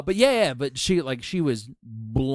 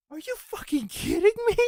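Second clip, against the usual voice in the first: imitated high-pitched disbelief; incredulous outburst. Girlfriend learning the actor is Scottish, incredulous. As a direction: play a girlfriend's stunned squeal.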